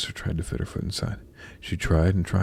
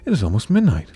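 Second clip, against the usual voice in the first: raised, alarmed storybook voice; higher and louder, realising time is up; crying out; 5 semitones higher, 5 dB louder than usual. A narrator's reading. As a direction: cry it out in mild alarm. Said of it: "she cried out".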